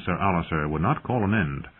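usual speaking voice

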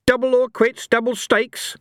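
high, loud taunting fury; furious challenge to Mister Fate; raging. Acted. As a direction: bounce with rage and goad him. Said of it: "dancing with rage".

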